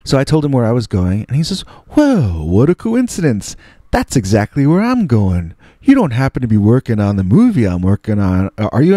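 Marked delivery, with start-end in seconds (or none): 1.9-9.0 friendly amazement with swooping pitch; Jim Brown. Retold or imitated. Star meeting young co-worker in elevator, delighted. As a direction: play an impressed, genial big star. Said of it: "he's being so nice to me".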